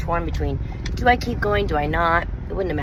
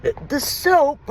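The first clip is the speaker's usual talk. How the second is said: mimicking husband; 11 semitones higher, slower than usual. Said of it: "he's like".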